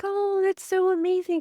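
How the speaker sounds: high, delighted gush; mock crowd swooning; gushing onlookers. Onlookers admiring someone cuddling a baby, gushing high. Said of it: "Everybody would be like".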